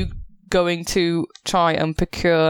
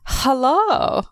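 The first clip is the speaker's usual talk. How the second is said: higher, drawn-out greeting; flirtatious officer; 9 semitones higher than usual. Acted. Officer meeting a stranger at the door, flirtatious and drawn-out. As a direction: greet the newcomer with obvious interest.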